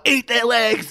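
high mock urging; egging orcs on. Viewer egging on orcs against the hobbits, high mock urging. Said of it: "But I'm also like".

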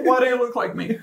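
high playful squeal; giddy, mock-startled question; excited anime fan. Twins mirror each other.

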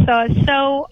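normal talking voice

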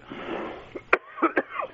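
a real dry cough. Real, not acted. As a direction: cough into the mic. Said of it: "excuse me while I cough".

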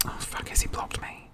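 hushed aside to oneself; worried whisper. Real, not acted. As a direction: whisper it as a private worry. Worried aside while searching phone.

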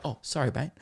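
soft, quick drop out of the rowdy act; suddenly normal apology. Retold or imitated. Mimicking a dude who bumped into him, suddenly civil and soft. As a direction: apologise quietly, as if caught out. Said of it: "the guy just snapped out".

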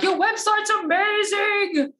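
high, drawn-out excited squeal; gushing couple. Retold or imitated. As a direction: gush like a thrilled client, stretching the words. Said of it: "our couples would be like".

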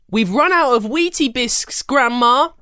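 put-on high, bright kid voice calling out; excited child calling out. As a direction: call out like an eager young girl.

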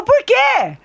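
put-on high shouted child's why; a son snapping back at mom. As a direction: yell it like a cross little boy. Child's quote retold.

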